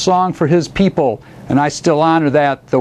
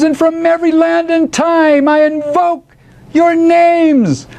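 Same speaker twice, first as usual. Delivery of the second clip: high, incantatory declamation; bellowed invocation; 9 semitones higher than usual. A narrator's reading. Poet calling the great spirits to the moon, bellowed. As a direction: declaim it skyward like a bard summoning spirits. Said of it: "he bellowed into the".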